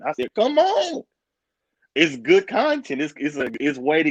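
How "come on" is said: high, thrilled yelp; eager high yelp; excited come on. Retold or imitated. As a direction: squeal it up high with glee. Retold excited reply.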